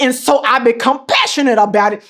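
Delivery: ordinary talk, nothing marked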